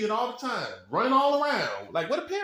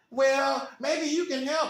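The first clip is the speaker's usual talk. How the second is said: higher, hopeful parent voice; pleading parent; 6 semitones higher, slower than usual. Acted. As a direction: ask the manager for help. Parent asking the manager.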